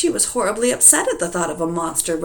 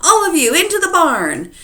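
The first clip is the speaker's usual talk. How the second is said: high, loud call to the cows; herding yell; 10 semitones higher, 10 dB louder than usual. Acted. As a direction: holler them into the barn.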